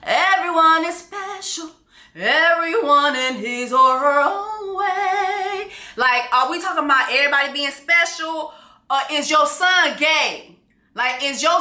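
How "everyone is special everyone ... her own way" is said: put-on high, slow singsong children's show voice; Barney singsong. Vlogger mocking the queen mother's word special, singsong. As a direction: sing it out like a kids' TV dinosaur. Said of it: "like Barney special".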